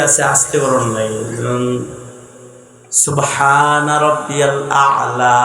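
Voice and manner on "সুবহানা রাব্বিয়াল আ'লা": slow, drawn-out chanted tasbih; slow recitation. Shown as a demonstration. Preacher showing how the prayer phrase is recited, slow chant. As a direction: recite it slowly, savouring each word. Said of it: "আস্তে পড়তে হয়".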